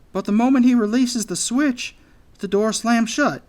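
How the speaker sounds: high, loud character voice; anxious anteater. A narrator's reading. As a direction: explain nervously, pitched well up.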